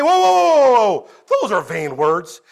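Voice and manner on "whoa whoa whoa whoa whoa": alarmed whoa. Acted. Stopping a dangerous attitude.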